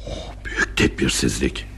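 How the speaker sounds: low, soft breath of shock; hushed dismay. Acted. Father seeing the necklace from the bag, hushed dismay. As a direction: let it out in a low, appalled undertone.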